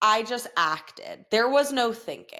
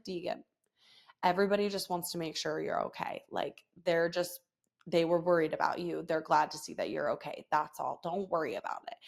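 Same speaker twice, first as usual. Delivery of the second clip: soft, lower, tender comforting; gentle reassurance; 4 semitones lower, 6 dB quieter than usual. Retold or imitated. Mother gently reassuring her embarrassed son Deegan. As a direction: quietly reassure your child.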